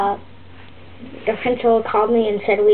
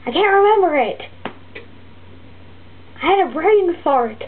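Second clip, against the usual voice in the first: frustrated outburst; 7 semitones higher than usual. Real, not acted. Kid blurts out frustration at forgetting the name. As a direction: blurt it out, annoyed at yourself.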